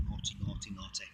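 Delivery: soft self-scolding, "naughty" murmured. Real, not acted. Caught eating on camera, a soft self-rebuke. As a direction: tell yourself off under your breath. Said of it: "talking with my mouth full again".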